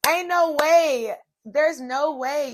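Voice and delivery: slow, high, loud disbelief. Retold or imitated. Telling viewers about the Lexus approval, slow amazed disbelief. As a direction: stretch it out, amazed. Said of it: "disbelief at getting approved".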